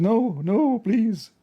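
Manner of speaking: high, pleading no-no; hammed-up frightened plea; mock pleading. Retold pleading at the mirror.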